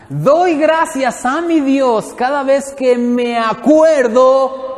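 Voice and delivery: very slow, high, loud drawn-out reading; slow motion reading. Acted. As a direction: stretch every word as if in slow motion. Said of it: "En cámara lenta, hermana". (lectura en cámara lenta)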